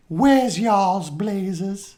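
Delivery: high, drawn-out New Orleans accent imitation; strong New Orleans accent. Quoting Mary Ann's best line from mall shopping, strong New Orleans accent. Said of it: "they got this strong New Orleans accent".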